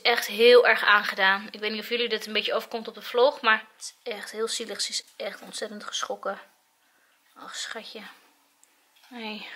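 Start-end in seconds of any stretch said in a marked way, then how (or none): 1.5-9.5 low, soft and pitying; hushed concern. Real, not acted. Owner beside the shaken dog at home, hushed and low. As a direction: let the voice sink low and quiet with concern.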